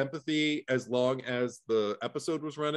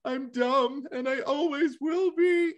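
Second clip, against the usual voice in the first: mock crying woman; 13 semitones higher, slower than usual. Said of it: "she's just crying because she's just like".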